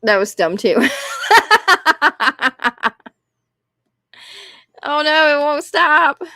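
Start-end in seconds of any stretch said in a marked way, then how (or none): 0.8-3.1 long, helpless cackle; sustained real laughter; real laughing fit. Real, not acted. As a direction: burst out laughing and keep going. Laughs at own dumb remark.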